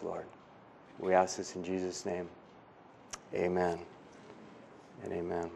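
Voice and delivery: soft, earnest pastoral prayer voice; spoken prayer. Acted. Pastor praying over the church, soft and earnest.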